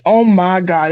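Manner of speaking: ordinary talk, nothing marked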